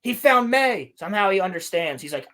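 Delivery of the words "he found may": higher and louder; hammed-up eager realisation; mock excited discovery. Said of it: "Yord's like".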